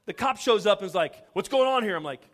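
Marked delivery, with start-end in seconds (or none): 1.3-1.9 high, loud questioning; mock cop demand. Cop turning up at a Bible study, loudly demanding. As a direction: demand answers like an arriving officer. Retold policeman arriving at a complaint.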